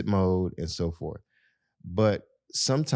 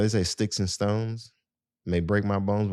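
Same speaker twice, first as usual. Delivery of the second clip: ordinary talk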